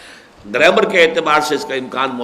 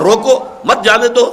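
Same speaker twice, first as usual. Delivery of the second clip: روکو higher and set apart, then مت جانے دو as one unit; pause after روکو; 7 semitones higher, 4 dB louder than usual. Shown as a demonstration. Lecturer stressing the real point, high pitch with a pause after روکو. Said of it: "یہ ہے اصل شے".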